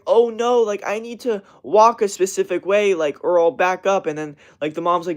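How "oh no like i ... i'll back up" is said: a hint of a fretting kid, higher and louder; mock worried kid. Ranter mocks a kid needing to walk a specific way, mock worried. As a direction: lightly imitate a fussing child, pitched up.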